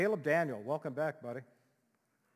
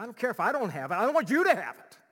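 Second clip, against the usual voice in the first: louder, higher, faster spiteful cry; spiteful outburst; 7 semitones higher, 8 dB louder, faster than usual. Acted. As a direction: spit it out with spite. Cruel envy acted out.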